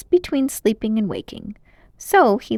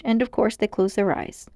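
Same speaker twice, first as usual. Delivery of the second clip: ordinary delivery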